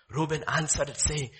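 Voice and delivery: ordinary delivery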